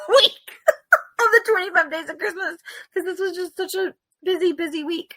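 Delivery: high squeal through laughter; laughing wail. Real, not acted. Co-host excusing her late uploads, in a high laughing wail. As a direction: let it go high and helpless with laughter. Laughs over being behind on posting.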